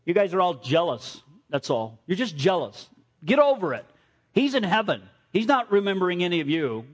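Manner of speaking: a hammed-up get-over-it jab; mock scolding. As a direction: playfully tell them off like a cheeky old man. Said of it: "one of the last things he said was".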